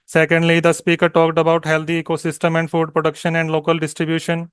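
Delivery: slow, even demo read of a retell-lecture answer; model exam answer read. Shown as a demonstration. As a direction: deliver it steady and unhurried, like the exam. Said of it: "किस speed से आपने उसको पढ़ना है".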